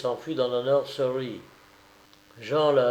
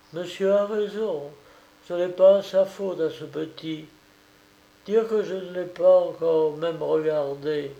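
put-on higher, tender pity over a baby; pitying nursemaid; 6 semitones higher than usual. Nursemaid looking at the frail baby in the cradle, tender pity.

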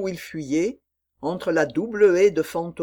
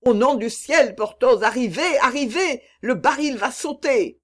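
desperate shout; 7 semitones higher, 4 dB louder than usual. A narrator's reading. Aramis calling Porthos, danger.

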